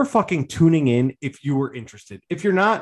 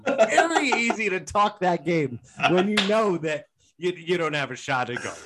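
sarcastic jab; 6 semitones higher, 3 dB louder than usual. Acted. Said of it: "the boys are fiery right now".

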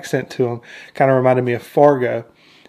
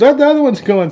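high, louder mock alarm; urgent alert; 12 semitones higher, 6 dB louder than usual. Trooper spots the other Jawa fleeing, high urgent alert. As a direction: sound the alarm in a retold cop scene.

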